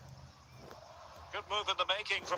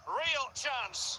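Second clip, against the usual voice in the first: sudden higher-pitched commentary exclamation; excited chance call; 7 semitones higher than usual. Real, not acted. Commentator seeing a real chance, excited. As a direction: lift the voice sharply at the opening.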